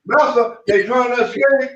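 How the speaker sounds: imitating a groveling servant; mock Sambo plea. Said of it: "Sambo was the one".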